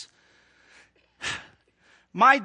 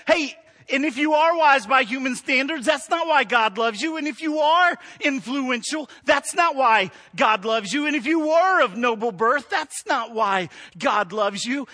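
preaching at full force; 4 semitones higher, 4 dB louder than usual. Acted. Preaching repeated refrain, louder.

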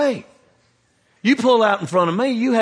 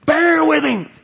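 emphatic call; 10 semitones higher, 5 dB louder, slower than usual. Acted. Pastor's answer to a pleading congregant.

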